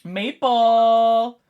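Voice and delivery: loud high call; excited call to the player. Real, not acted. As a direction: shout the name out. Calling out a name.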